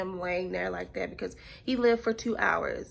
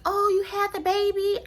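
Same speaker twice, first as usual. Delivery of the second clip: much higher and louder, chirpy; mock cheery question; 12 semitones higher, 9 dB louder than usual. Mocking people who ask about the baby, high and loud. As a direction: ham up a clueless bright asker.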